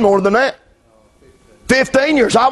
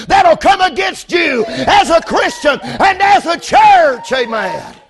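shouted, rhythmic sermon cadence; full force preaching; 6 semitones higher than usual. Acted. Preacher shouting at the congregation about Goliath, full force preaching. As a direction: hammer each line home to the church.

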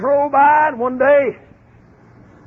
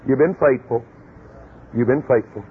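tender voice of God; 11 semitones lower than usual. Retold or imitated. God praising Caleb and Joshua, low and tender. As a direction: gently reassure the faithful boys. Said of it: "God tapped him".

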